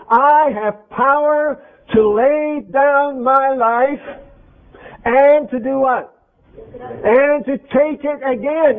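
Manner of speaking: very slow, measured words of Jesus; solemn scripture quote. Acted. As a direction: draw each word out like holy writ. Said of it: "He said".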